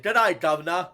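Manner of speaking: badly faked British-Minnesota accent; botched Minnesota accent. Shown as a demonstration. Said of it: "trying to do a Minnesota accent".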